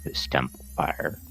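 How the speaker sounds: whispered echo of the refrain. Pretend. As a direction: breathe it out as a whisper. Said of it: "spoken word album".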